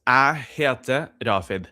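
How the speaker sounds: Trondheim dialect, "Jeg" said as "ah"; "Jeg" with no y, just "ah"; Trondheim pronunciation. Shown as a demonstration. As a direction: turn "Jeg" into a plain "ah". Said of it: "becomes "a".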